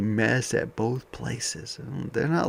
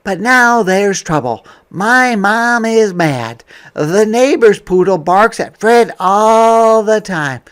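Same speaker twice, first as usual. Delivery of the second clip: higher and louder, building trouble; dramatic storytime; 11 semitones higher, 12 dB louder than usual. Acted. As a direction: read it like the plot thickens. Reading picture book aloud.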